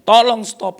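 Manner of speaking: high, loud order to stop; imitated shouted demand. Preacher voices the guest confronting the pianist, high loud demand. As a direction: demand it as an unsatisfied complainer. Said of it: "Nggak puas dia berdiri".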